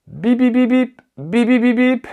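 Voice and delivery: high, loud "beep beep" bursts; be the ringing phone, loud and piercingly high; imitated phone ring. (imitation d'une sonnerie de téléphone)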